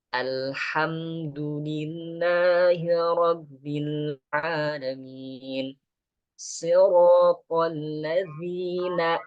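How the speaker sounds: very slow, careful chant; slow recitation. Shown as a demonstration. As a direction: recite it slowly and lightly. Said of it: "Pelan-pelan aja".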